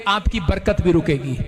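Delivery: ordinary talk, nothing marked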